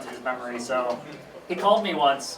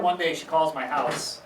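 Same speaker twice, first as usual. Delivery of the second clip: ordinary delivery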